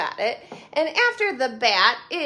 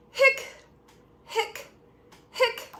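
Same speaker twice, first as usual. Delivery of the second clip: hiccuping; 12 semitones higher, slower than usual. Acted. Voicing skeleton's hiccups.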